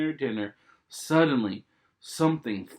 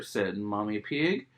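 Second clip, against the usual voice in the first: usual speaking voice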